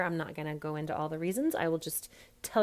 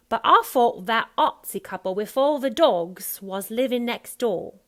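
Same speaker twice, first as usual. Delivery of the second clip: loud, higher Cockney character line; Cockney accent; 5 semitones higher, 12 dB louder than usual. Shown as a demonstration. Coach running the whole line for the actor, Cockney accent. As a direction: belt it out in broad Cockney. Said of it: "about Cockney".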